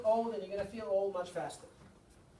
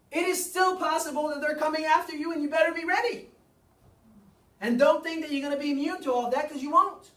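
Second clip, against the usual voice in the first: loud warning; 6 semitones higher, 7 dB louder than usual. Real, not acted. Warning audience.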